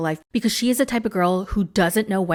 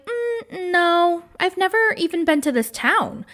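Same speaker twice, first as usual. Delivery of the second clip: higher-pitched, reluctant imitation; evasive reply; 8 semitones higher than usual. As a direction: act out a hesitant, evasive answer. Joy's evasive answer, acted.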